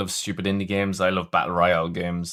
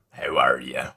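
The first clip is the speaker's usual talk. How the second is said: attempted pirate voice; 10 semitones lower, slower than usual. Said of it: "I'd like someone to be like a pirate voice".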